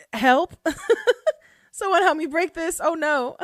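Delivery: high, loud mock cry for help through laughter; laughing imitation of someone begging for help; laughing mock plea. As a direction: imitate a panicked plea while cracking up. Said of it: "I'm laughing".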